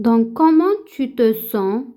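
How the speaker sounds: ordinary delivery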